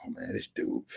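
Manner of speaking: very soft, low grumble; defeated mutter. Acted. Accusers walking away from Jesus, muttering low. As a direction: mutter it under your breath, acting beaten.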